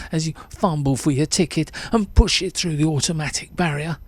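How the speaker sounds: ordinary delivery, nothing marked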